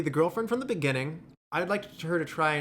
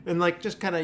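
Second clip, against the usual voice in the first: ordinary talk, nothing marked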